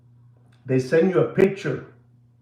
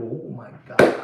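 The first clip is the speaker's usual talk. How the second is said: hushed shocked gasp; 9 semitones lower than usual. Retold or imitated. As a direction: murmur it in stunned disbelief. Imitates own shock.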